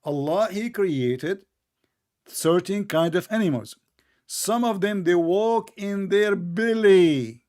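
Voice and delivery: drawn-out, emphatic phrases with pauses; slow, careful explaining. Shown as a demonstration. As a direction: make them listen to every word. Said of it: "Listen carefully".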